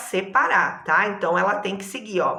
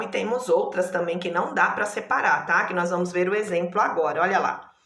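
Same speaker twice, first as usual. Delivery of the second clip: ordinary talk, nothing marked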